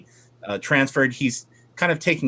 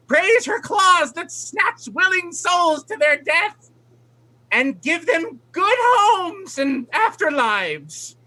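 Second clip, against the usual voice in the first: flighty eccentric aunt. Acted. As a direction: gush like a doting, batty old hag. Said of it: "And she says".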